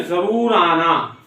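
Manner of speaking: slow, stressed जरूर with a falling tune; emphatic invitation. Shown as a demonstration. Teacher showing how an insistent invite sounds, stressing जरूर. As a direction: lean hard on जरूर, drawn out. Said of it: "अब जैसे हम बोलते हैं ना".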